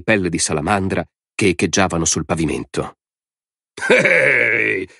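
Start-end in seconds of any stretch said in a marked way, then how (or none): 3.9-4.9 high chuckled call; chuckling. A narrator's reading. Man snickers after the departing woman, chuckling. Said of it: "disse Tommy ridacchiando".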